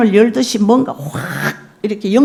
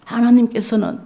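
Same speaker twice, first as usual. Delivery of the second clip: usual speaking voice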